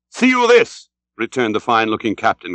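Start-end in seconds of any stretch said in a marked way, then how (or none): 0.1-0.9 high, loud answering hail; calling back. A narrator's reading. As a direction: call it back across to the other ship. English captain answers Ahab's hail.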